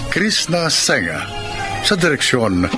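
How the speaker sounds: usual speaking voice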